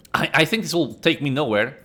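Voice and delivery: ordinary talk, nothing marked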